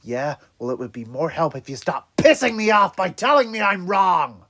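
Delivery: loud, angry retort. Acted. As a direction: snap back angrily.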